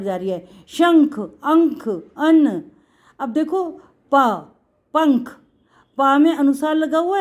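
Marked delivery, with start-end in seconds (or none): 0.7-2.6 शंख, अंक, अन्न called out loud, stressing the nasal; teacher sounding out शंख with a clear ng; loud, deliberate demo of अंक and अन्न. Shown as a demonstration. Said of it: "शंख की आवाज़ हमारी इस वाले ना के ऊपर".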